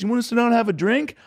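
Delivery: high caricatured praise. Retold or imitated. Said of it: "He goes".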